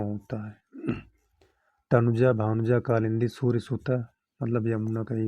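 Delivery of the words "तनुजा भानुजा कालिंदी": ordinary talk, nothing marked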